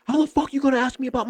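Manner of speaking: high, fast angry outburst; furious homeowner. Retold or imitated. Roided homeowner yelling at the salesman about his job, high and fast. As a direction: lose it on him. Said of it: "And he just lost it".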